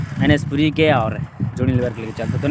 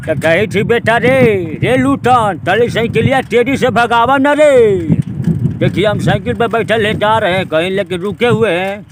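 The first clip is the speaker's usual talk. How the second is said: higher, louder Bhojpuri star voice, slow; Anand Mohan impression; 7 semitones higher, 7 dB louder, slower than usual. Bhojpuri star calling to son on a bicycle, loud and high. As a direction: call out in broad Bhojpuri like a folk hero. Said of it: "तो आनंद मोहन को सुनाऊंगा".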